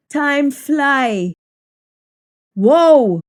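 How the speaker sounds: ordinary talk, nothing marked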